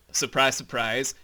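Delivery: ordinary talk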